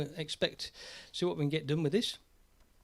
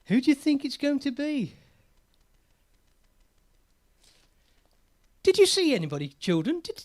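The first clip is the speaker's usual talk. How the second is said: put-on children's presenter; 9 semitones higher, 6 dB louder than usual. Puppet show host teasing children.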